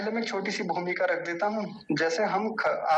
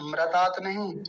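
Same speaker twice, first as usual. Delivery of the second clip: ordinary talk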